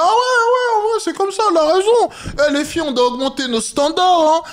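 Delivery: mock a naive teen hyping herself up; high-pitched caricature of a TikTok girl; imitating young girls. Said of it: "elles vont dire".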